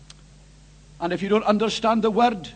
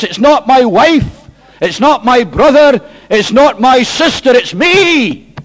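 loud, driving confession building higher; full-force preaching; 4 semitones higher, 15 dB louder than usual. Acted. Preacher voicing a sinner before holy God, loud impassioned confession. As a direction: pound out each denial toward a cry.

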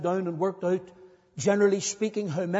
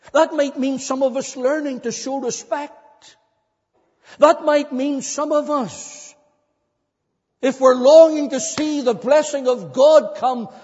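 higher, insistent pulpit exhortation; impassioned preaching; 7 semitones higher, 7 dB louder than usual. Real, not acted. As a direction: press each repeated point on the congregation. Preaches at full force.

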